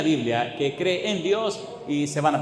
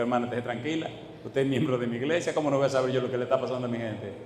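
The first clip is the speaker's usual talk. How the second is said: softer, calm, quick reassurance; reassuring pastor; 5 semitones lower, 6 dB quieter, much faster than usual. Retold or imitated. Pastor to worried sister, soft reassurance. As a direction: settle her down gently.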